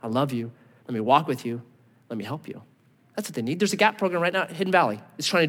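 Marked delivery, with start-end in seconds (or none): 0.0-2.6 gentle encouragement. Retold or imitated. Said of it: "somebody come and say".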